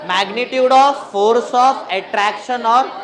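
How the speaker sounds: loud, very slow, stretched-out chant; drawn-out syllables, left hanging for students to finish; class recitation. Real, not acted. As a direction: lead the class word by word. Leading class in repeating definition.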